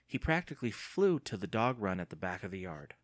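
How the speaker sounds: ordinary delivery, nothing marked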